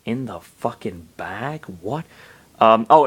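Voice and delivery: ordinary delivery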